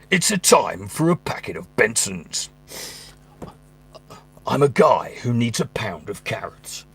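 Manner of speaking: slow, whispered trailer voice over groceries; mock-epic trailer read of a shopping list; trailer voice while shopping. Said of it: "Does he have to talk like that all the time".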